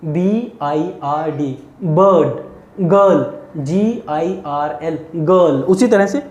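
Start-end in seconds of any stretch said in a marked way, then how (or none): 0.0-1.5 B I R D spelt out slowly letter by letter; slow classroom spelling. Shown as a demonstration. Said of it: "जैसे मैंने उसमें क्या बताया था". 1.8-2.8 bird stretched very slowly with a rising end; teacher stretching bird; drawn-out model. Shown as a demonstration. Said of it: "जैसे मैंने उसमें क्या बताया था".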